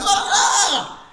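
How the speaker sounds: high-pitched comic mouse shriek; mock frightened scream. Mouse seeing a trap in the package, mock scream. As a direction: play a startled mouse's squeal.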